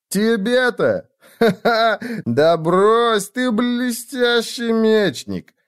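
high, laughing, drawn-out exuberance; boisterous praise. Acted. Friend cheers up the aching winner, boisterously laughing. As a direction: laugh it off and gush with admiration.

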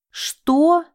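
high, loud outraged cry; shocked shout. Acted. Девушка кричит, возмущённая обвинением. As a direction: shout it in disbelief. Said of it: "крикнула она".

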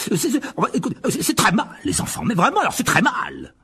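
high, flustered mock scolding; stammering. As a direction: stammer a hasty reprimand to appease the wife. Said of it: "balbutia-t-il en voyant les yeux de sa femme". (gronder en balbutiant, voix aiguë)

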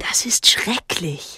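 slow horrified whisper. Acted. Boy hearing of the drowned brothers, whispering. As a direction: breathe it out, barely voiced.

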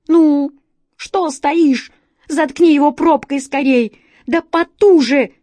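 loud, high urgent yelling; hurried shout. A narrator's reading. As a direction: shout him into action fast. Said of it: "торопливо прикрикнул".

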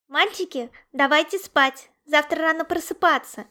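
put-on exasperated girlish complaint; indignant girls. A narrator's reading. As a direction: tell the boys off for keeping you up. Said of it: "возмутились девочки-паровозики".

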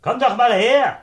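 high, loud shout to someone far off; calling out. Acted. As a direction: yell for him to come over. Zöllner ruft Kollegen herbei. (laut herüberrufen)

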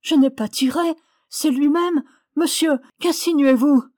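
high, loud indignant denial; accused young woman, agitated and shrill with alarm; distraught protest. A narrator's reading.

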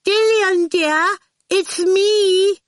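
high, loud singsong call. A narrator's reading. Miss Potter head round the door, calling her friend. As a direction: hail a friend inside, high and drawn out. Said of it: "she called".

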